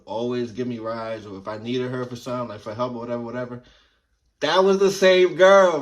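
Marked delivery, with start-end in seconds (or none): 4.4-5.8 loud, slow punchline reveal; emphatic reveal. Real, not acted. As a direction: land the twist, big and slow.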